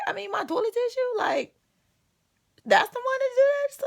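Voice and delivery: high, quick panicky squeak; mock panic. Retelling to friends how scared she got, high panicky squeak. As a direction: talk fast in a frightened little squeal. Panicking at the crazy girl story.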